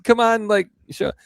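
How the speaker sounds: squeaky frustrated come-on; exasperated viewer. Retold or imitated. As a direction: beg the cameraman to just show it. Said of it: "and you're like".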